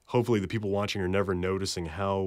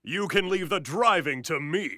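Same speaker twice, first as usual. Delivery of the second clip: booth take, second alt; 9 semitones higher, 3 dB louder than usual. Acted. Alt take with laugh after.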